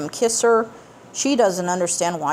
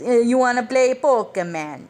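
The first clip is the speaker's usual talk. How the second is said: a light mock Jamaican-ish lilt, a bit higher; mock Jamaican-ish accent; 5 semitones higher than usual. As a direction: tease the Jamaican-ish accent people mistake it for. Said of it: "It may sound vaguely like somebody is".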